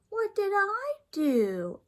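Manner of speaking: put-on girl pleading innocence to mother; innocent protest. A narrator's reading. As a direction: play wounded innocence. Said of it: "asked D W".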